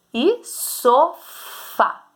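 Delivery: "sofá" drawn out very slowly, syllable by syllable; slow sounding out. Shown as a demonstration. Teacher sounding out "sofá" for pupils, very slow. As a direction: stretch "sofá" so the strong syllable shows. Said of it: "falar com calma pra você descobrir".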